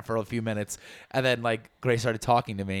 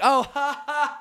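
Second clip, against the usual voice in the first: fake laugh; 14 semitones higher, slower than usual. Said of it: "we were like".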